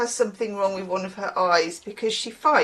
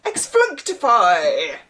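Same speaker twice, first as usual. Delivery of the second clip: mock spell-casting, drawn out; Harry Potter spell; slower than usual. Reader likens a word to Harry Potter magic, mock spell-casting. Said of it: "Back in the old, uh, Harry Potter days".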